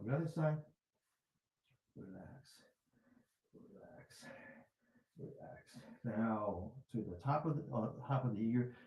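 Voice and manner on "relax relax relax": soft whispered relax. Shown as a demonstration. Teacher demonstrating the other side, whispering. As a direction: breathe it out, almost no voice. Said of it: "whisper to ourselves by saying".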